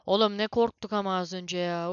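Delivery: loud, scared rapid talk; loud panicked talk. Real, not acted. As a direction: talk loudly through the fright. Panicking in horror game.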